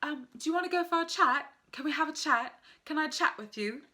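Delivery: playful imitation of a British islander; British accent. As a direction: put on a cheerful UK accent for "chat". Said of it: "anytime I wanna say the word, it's like the accent just comes".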